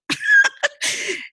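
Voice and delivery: a burst of high laughter after the punchline; high bright laugh. Real, not acted. Mother laughing after telling the son about crying in the closet, high laugh. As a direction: laugh freely at the memory. Laughing at her own joke.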